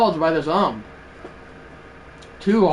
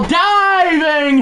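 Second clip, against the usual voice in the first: excited call; 11 semitones higher, 4 dB louder than usual. Real, not acted. Calling a diving play.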